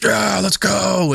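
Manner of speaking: high, slow hammed-up hype; mock eager cheer. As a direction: drag it out like a rowdy Viking crew. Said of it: "everyone's like".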